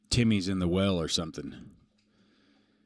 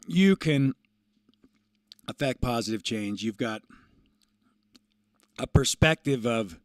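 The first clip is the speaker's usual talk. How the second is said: louder, earnest uplifting pep talk; motivational speech; 3 semitones higher, 5 dB louder than usual. Acted. Streamer gives viewers an uplifting message, earnest and louder. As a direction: preach positivity to the viewers.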